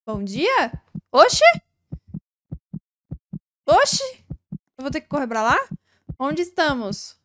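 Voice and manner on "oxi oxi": sudden high startled cry; startled exclamation. Real, not acted. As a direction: jump at a scare. Startled by something in game.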